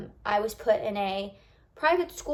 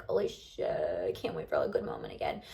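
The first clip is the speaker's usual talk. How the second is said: whispered relief; 7 dB quieter than usual. Retold or imitated. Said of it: "be like".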